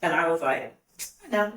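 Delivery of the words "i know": short, quick reply to a proposal; just like that. Retold or imitated. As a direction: answer promptly, as if reenacting it. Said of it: "Just like that".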